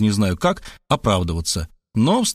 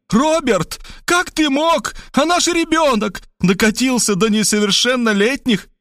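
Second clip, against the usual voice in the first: high female voice pleading in dismay; wailing appeal; 11 semitones higher than usual. Acted. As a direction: wring your hands and wail at Robert. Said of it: "заломила руки и воззвала ко мне". (высокий женский голос, заламывая руки)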